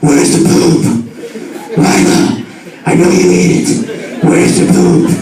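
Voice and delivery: rough, growled interrogation in a hero voice; Batman. Acted. Owner interrogating his dog about the poop, Batman voice. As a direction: growl it like a masked vigilante questioning a suspect. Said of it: "my Batman voice".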